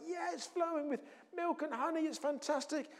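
high-pitched, softly gushing excitement; excited report. Acted. Spies come back reporting on the land, excited.

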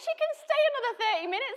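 high, fast laughing reply; fast high laughing quote; laughing imitation of youth leader. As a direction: quote him while laughing. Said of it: "He's like".

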